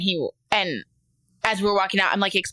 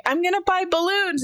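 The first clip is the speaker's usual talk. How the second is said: put-on high-pitched little-kid announcement; imitated eager child; 9 semitones higher than usual. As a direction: mimic a small child, pitched up. Imitates excited son at store.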